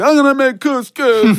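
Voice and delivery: put-on high, proud drunk announcement; mock drunk declaration; drunk resolve. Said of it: "And I was like".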